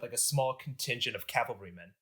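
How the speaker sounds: ordinary talk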